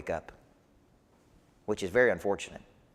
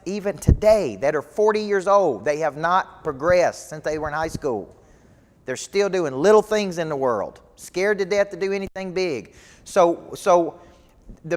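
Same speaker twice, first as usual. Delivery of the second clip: preaching; 5 semitones higher, 6 dB louder than usual. Acted. Coach rallies live audience.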